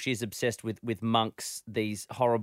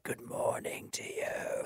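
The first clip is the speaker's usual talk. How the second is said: hushed, eerie whispered greeting; monk whisper; 7 dB quieter, slower than usual. Retold or imitated. Little girl greets her teacher in a slow monk whisper. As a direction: whisper it slowly, like a hooded monk. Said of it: "just like".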